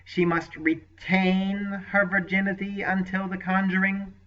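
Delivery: high, pointed, rising question. Acted. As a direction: press the question with sly insistence. Sorcerer presses the point.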